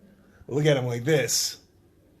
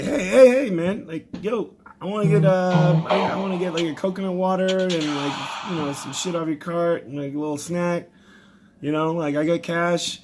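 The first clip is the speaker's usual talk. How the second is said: louder, higher, eager hail; calling out; 5 semitones higher, 6 dB louder than usual. Retold or imitated. Comedian hailing the drinks-cart steward, loud and eager. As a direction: flag the steward down across the aisle.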